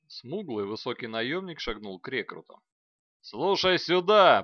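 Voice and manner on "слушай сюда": loud slow drill bark; barked command. A narrator's reading. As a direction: bark it at the recruits. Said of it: "пролаял он". (ротный лает на рекрутов, громко и медленно)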